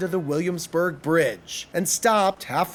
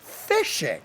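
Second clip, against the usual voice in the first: ordinary delivery, nothing marked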